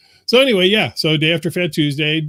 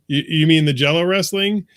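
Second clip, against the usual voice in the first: normal talking voice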